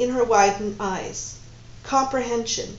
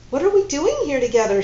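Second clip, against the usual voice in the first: bewildered; 6 semitones higher, 7 dB louder, faster than usual. Acted. Sudden bewildered question.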